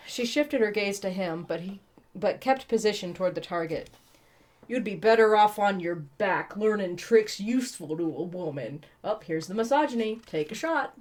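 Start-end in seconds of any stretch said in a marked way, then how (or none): none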